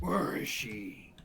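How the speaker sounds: slow, growled Batman line; imitating the dark knight, slowly. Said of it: "He's doing a Bat-, dark, a Batman Begins voice".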